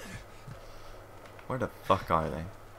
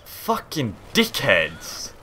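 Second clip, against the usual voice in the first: raging; 3 semitones higher, 4 dB louder, much slower than usual. Real, not acted. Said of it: "Just raging".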